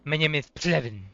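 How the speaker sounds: loud lisped "My name is Plevin"; lisping Kevin voice. Pretend. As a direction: lisp it like Kevin introducing himself. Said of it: "when Kevin has a lisp".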